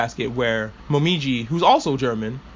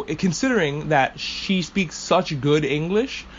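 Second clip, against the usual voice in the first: ordinary delivery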